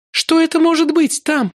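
put-on high, worried young woman. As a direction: ask it high and uneasy. Ruth's voice held. (высоким встревоженным голосом)

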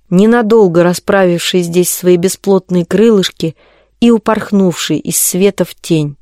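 ordinary delivery

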